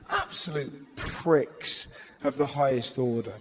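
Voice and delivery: ordinary delivery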